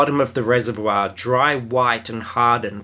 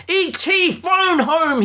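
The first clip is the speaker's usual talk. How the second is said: bellowed; slower than usual. A narrator's reading. E T calling out his battle cry, high bellow. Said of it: "he bellowed with reckless abandon".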